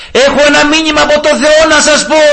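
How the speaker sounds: re-enacted open-air announcement, pitched high; loud public proclamation. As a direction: call out to a gathering crowd. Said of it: "Έτσι δυνατά".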